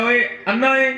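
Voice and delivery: high, sharp call of a blind beggar. Retold or imitated. Blind man on the bazaar cries out at the stranger, high call. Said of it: "وہ پکار کے کہتا ہے".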